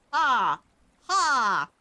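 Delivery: short loud ha ha at a chat joke; two loud barks of laughter. Real, not acted. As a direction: laugh it off, caught out. Reacting to chat jab.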